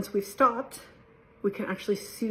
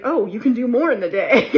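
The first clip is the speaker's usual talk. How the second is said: mock pitch; 3 semitones higher, 9 dB louder than usual.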